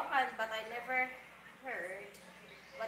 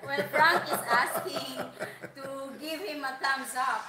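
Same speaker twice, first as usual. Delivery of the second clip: laughing through the words, louder and slow; 2 semitones higher, 8 dB louder, slower than usual. Real, not acted. Passing a viewer's thumbs-up request to the host, laughing. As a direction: chuckle your way through relaying the request.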